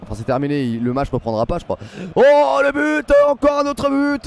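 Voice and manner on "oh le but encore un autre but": loud, high goal announcement; excited sports commentary. Real, not acted. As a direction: call the goal at full excitement. Live goal call in stream. (annonce de but enflammée)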